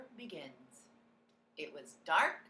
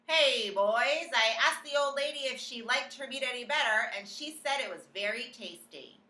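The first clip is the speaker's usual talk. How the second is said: calling out; 6 semitones higher, 10 dB louder than usual. A narrator's reading. Waiter calling to cooks.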